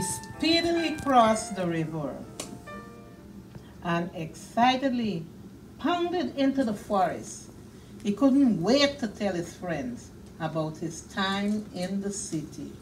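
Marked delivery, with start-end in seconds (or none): none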